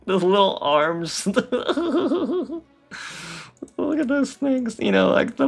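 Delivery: giggling at little arms; laughing. Real, not acted. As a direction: laugh through the words. Laughing at the design.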